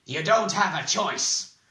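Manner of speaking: loud, high, slow angry shout; explode, leaving no choice; angry outburst. A narrator's reading. Bald man overruling his prisoner, exploding angrily. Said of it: "The bald man's breath exploded angrily".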